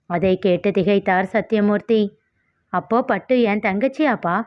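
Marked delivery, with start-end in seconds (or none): none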